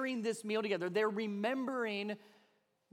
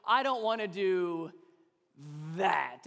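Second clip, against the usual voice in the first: sulky heavy "that"; stress on that; 2 semitones lower, slower than usual. Shown as a demonstration. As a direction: lean hard into "that". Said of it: "you really emphasize the that".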